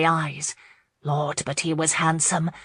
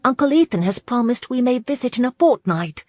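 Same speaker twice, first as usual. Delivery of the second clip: higher-pitched, earnest proclamation; honest young lady; 7 semitones higher, 3 dB louder than usual. A narrator's reading. Abby shares news of a promised visit, honestly. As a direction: announce it sincerely in a lifted voice. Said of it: "Abby proclaimed honestly".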